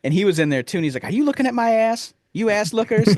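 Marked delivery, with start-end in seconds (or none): 1.1-3.2 put-on very high, childish accusing voice; high little-boy voice accusing; Danny DeVito as Simon. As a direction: squeal it like a cheeky kid. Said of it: "Danny DeVito".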